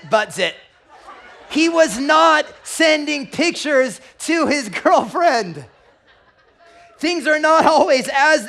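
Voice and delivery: loud, high, laughing shout; nearly shouting it through the laughter; roaring with laughter. Real, not acted. Pastor delivering the punchline to a laughing crowd, loud and high.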